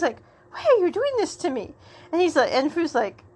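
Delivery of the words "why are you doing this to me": high, quick, wounded whine; mock protest. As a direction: act out a hammed-up complaint. Acted protest after being slapped.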